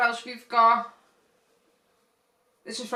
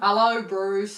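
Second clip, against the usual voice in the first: slow, louder put-on Cockney greeting; mock Cockney shark; 5 dB louder, slower than usual. Cockney accent, shark greeting a friend, slow and mock. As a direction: greet him like a hammed-up London shark. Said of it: "We're going Cockney Shark".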